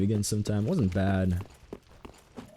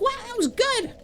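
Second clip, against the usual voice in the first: put-on squeaky, much higher kid's voice; excited seven-year-old. Streamer quoting their seven-year-old self's movie review, excited child voice. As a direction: imitate a little kid gushing, pitched way up. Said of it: "the rating of a seven-year-old at the time".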